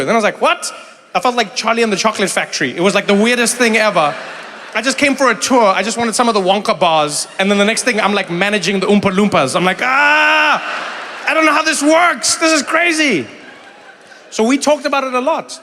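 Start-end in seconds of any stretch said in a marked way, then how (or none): 0.4-0.7 shocked what. Retold or imitated. Shocked reaction quoted. 9.8-13.3 high, loud flustered yell; overwhelmed new boss losing it; high panicked shout. Retold or imitated. Panicked Oompa manager quote.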